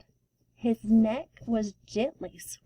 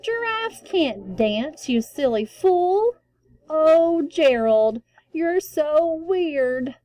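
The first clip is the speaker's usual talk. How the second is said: high, slow mocking taunt; 7 semitones higher, 5 dB louder, slower than usual. A narrator's reading. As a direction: jeer at him from the crowd. Animals taunting Gerald.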